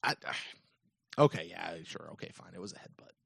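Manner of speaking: whispered, grudging give-in; reluctantly conceding the headbutt in a whisper. Real, not acted. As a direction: concede it under your breath. Grudging concession under breath.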